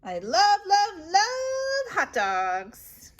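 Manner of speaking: slow, high, swooning love; gushing. Acted. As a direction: swoon over the popcorn. Gushing over snacks.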